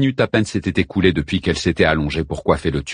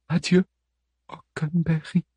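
Helen's voice; 9 semitones higher, 4 dB quieter than usual. Acted. Helen's farewell after stabbing.

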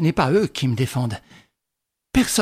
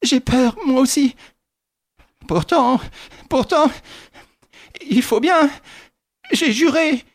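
higher, tense frightened voices; fearful urging; 11 semitones higher, 4 dB louder than usual. A narrator's reading. Widow and nephew, both afraid, forcing themselves. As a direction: push through the fear.

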